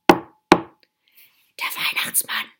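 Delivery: slow, awed stage whisper. A narrator's reading. Little sister hearing a knock at the door, whispering. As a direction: whisper it slowly in suspense. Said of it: "flüsterte Lina".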